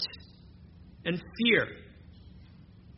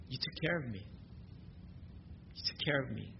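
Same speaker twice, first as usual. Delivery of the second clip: softer, a quiet thankful prayer; grateful prayer; 5 semitones lower, 14 dB quieter, faster than usual. Retold or imitated. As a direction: speak your thanks softly to God. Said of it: "and say".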